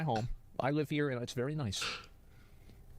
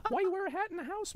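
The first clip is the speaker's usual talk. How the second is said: mocking high voice; 11 semitones higher, 11 dB louder than usual. Mocking the hat.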